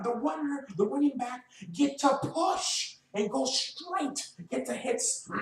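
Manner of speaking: high, strained, exasperated push about the running game; pleading with coaches who never listen; frustrated rant. Real, not acted. Fan venting at his team's coaches, frustrated and pitched high. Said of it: "I am getting very frustrated".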